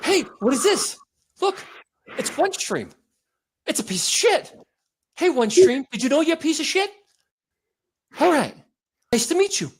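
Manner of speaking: high, out-of-breath chummy greeting; Mark Wahlberg. Acted. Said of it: "Always sounds like he's out of breath".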